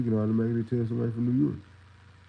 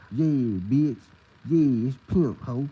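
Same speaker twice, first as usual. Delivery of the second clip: lightly voiced, a bit louder [name] drawl; [name] impression; 3 semitones higher, 5 dB louder, much slower than usual. Imitating the rapper to show how country he talks. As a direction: toss it off like [name].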